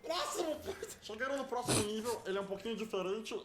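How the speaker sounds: high squeaky alien cartoon voice; Stitch voice. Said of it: "Por que tá imitando o Stitch do nada?".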